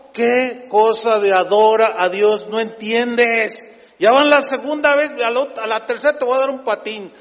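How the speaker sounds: loud, exasperated scolding of John; mock angry scolding. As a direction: threaten him like a fed-up angel. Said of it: "me emocioné". (regaño exasperado y fingido, en voz alta)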